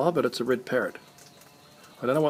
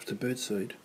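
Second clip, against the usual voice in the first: ordinary talk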